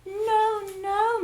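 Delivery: high, loud protest; crying out. Acted. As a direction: cry out in alarm. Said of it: "Miss Honey cried out".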